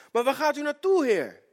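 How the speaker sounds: high, loud, urgent asking; urgent question. Retold or imitated. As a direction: call out after a leaving master. Peter anxiously asks Jesus.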